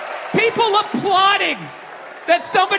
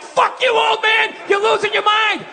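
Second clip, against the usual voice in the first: mock furious yell; 5 semitones higher than usual. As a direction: bark it at the old man like a partisan. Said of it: "you're just like".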